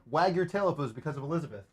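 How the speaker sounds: dog talk. Real, not acted. Owner asking his dog about Elizabeth, soft baby talk. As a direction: coax the dog sweetly.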